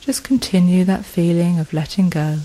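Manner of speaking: ordinary delivery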